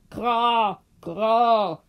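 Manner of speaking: loud cawing crow imitation; loud crow calls. A narrator's reading. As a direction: caw it out like a crow. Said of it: "begann mit lauten Krähenrufen".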